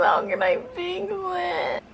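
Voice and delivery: high, slow worried whine. Real, not acted. Repeats in panic, varied voices.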